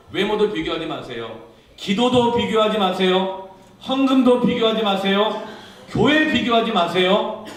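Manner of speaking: loud, high, hammered exhortation; full-force preaching. Real, not acted. Preacher urging the congregation not to compare, full-force preaching. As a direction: drive each repeated command home forcefully.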